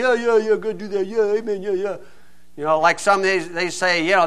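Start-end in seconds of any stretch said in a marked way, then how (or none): 0.0-2.0 rapid-fire congregation cheers, half-voiced; mild mock hollering; mock crowd yelling. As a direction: imitate an amen-shouting crowd without volume. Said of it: "they're yelling and screaming".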